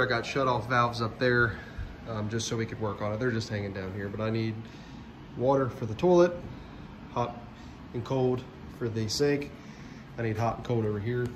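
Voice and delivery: ordinary talk, nothing marked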